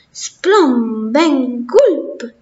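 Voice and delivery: breathy cartoon sound words; comic-book gibberish. A narrator's reading. Mouse from the comics babbling in comic-book speech. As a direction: spit out comic noises. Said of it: "parlava solo la lingua dei fumetti".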